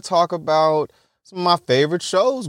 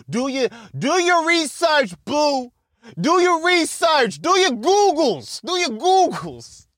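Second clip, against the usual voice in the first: high shout; 10 semitones higher than usual. Real, not acted. Urging listeners to research.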